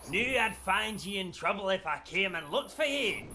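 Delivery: gruff Scottish dwarf quote, hammed up; bad Scottish accent. Reading the card's quote in a terrible Scottish accent. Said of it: "outside of that terrible, terrible accent".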